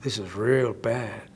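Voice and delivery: slow, low Texan drawl on "real bad"; mock Texan emphasis. Shown as a demonstration. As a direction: lean into a Texan twang, drawing out "real". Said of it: "I'd be able to say it with much more emphasis".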